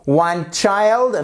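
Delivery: ordinary talk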